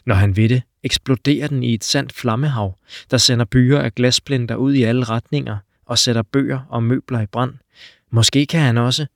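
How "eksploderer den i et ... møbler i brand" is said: ordinary delivery, nothing marked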